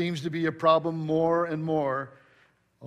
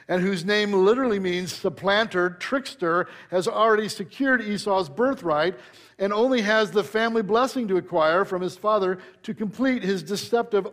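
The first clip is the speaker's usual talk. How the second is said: raised-pitch sermon delivery, building; preaching; 3 semitones higher than usual. Acted. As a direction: proclaim it from the pulpit.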